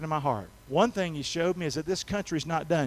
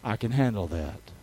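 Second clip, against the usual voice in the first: much lower, unruffled voice of God; God's calm answer; 10 semitones lower than usual. Retold or imitated. God answers the preacher's cry for help, calmly. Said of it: "you know what God cried back to me".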